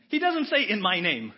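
loud, high emphatic declaration; preacher hammering the point home; emphatic preaching. Real, not acted. Preacher to congregation, loud and emphatic.

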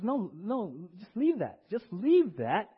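a preacher voicing Jesus, insistent and loud; voicing Jesus pleading. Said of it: "And Jesus".

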